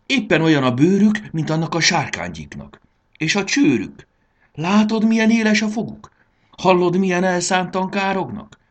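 high, loud, urgent exclamations; alarmed shouting. A narrator's reading. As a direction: cry out a warning, pitch held high. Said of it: "kiáltotta Fanny". (magas, izgatott kiabálás)

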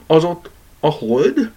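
high, slow and timid, almost squeaky; a shy teddy bear's scared question. A narrator's reading. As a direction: ask it small and a little frightened. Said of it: "kérdezte megszeppenve".

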